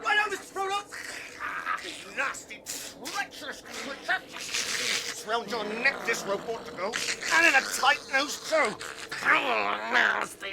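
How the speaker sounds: high, heated telling-off; angry scolding. Acted. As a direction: scold the treacherous creature. Sam scolds Gollum angrily.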